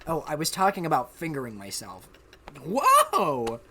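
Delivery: normal talking voice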